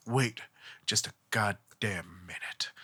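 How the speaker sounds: a low whispered "wait", half-hammed; whispered objection. Inoue rising from the stands to object, whispered. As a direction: hush it out like a dramatic interruption.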